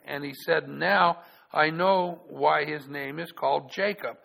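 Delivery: loud, measured Bible reading; solemn public reading of scripture. Acted. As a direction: read it out like the pulpit Bible.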